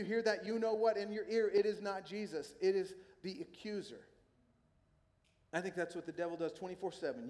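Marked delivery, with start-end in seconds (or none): none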